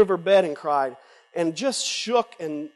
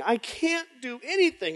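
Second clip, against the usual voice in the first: voice breaking into a cry; 7 semitones higher than usual. Retold or imitated. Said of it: "I said".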